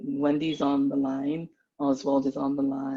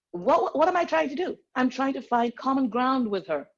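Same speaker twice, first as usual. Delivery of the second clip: usual speaking voice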